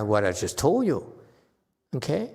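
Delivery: ordinary talk, nothing marked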